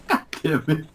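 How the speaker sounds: high, exasperated through laughter; laughing curse. Real, not acted. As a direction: curse while cracking up. Group cracks up at costume.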